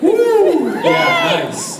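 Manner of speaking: high, loud over-caffeinated whoop; buzzing on coffee; excited whoop. Real, not acted. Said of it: "I had too much coffee".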